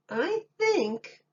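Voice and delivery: put-on high, childlike piping; a small boy's high voice; little boy voice. A narrator's reading. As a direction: play the little boy, bright and sure of himself. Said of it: "said the little boy".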